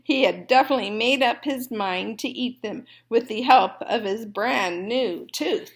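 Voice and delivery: ordinary delivery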